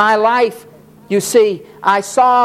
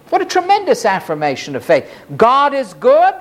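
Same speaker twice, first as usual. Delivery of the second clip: ordinary talk, nothing marked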